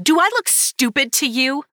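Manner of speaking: high, loud angry snap; snapped. A narrator's reading. Narrator to Dustin, snapping angrily. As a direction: bite back at him sharply. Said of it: "I snapped".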